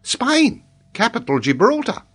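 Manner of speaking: high-pitched schoolboy giving his answer; confident little pupil; child reciting. Acted. Child recites wrong geography.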